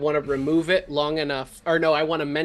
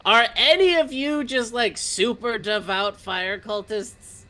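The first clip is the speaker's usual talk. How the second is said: raised, higher question thrown to a group below; calling down; 5 semitones higher than usual. Acted. Player asks the cultists below about devotion, calling down. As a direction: call it out, then draw out the end. Removes bubble, calls down the hole.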